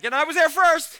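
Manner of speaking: proud, loud bragging blurt; boastful. Retold or imitated. John boasting to Mary, loud and proud. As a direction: brag that you got there first. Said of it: "And John's like".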